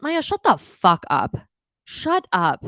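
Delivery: irritated yell at a cast member; exasperated outburst. Real, not acted. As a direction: snap at the screen, fed up. Snapping at a cast member on screen.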